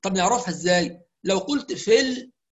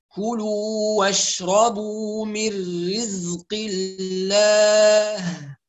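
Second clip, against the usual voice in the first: slow melodic tajwid reading; Quranic recitation; much slower than usual. Shown as a demonstration. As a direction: recite slowly and evenly. Recites verse.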